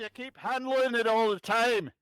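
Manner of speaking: high put-on character voice; high, put-on voice for a teasing relative. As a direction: play the figure in a high voice.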